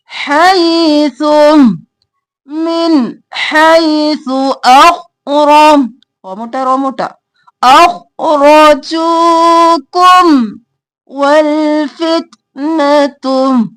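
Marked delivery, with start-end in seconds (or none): 0.1-5.9 slow, drawn-out tajweed chant; Quran recitation. Shown as a demonstration. Reciting verse for students. 7.6-13.7 slow, drawn-out tajweed chant; Quran recitation. Shown as a demonstration. Reciting verse for students.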